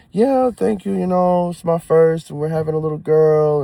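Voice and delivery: proud dad-to-be gushing to strangers; replying to well-wishers with eager excitement; excited reply. Retold or imitated. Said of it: "they hear my excitement".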